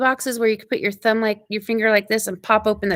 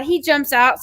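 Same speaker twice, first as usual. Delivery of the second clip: usual speaking voice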